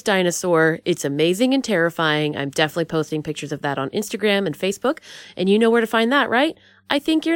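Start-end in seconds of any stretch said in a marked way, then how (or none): none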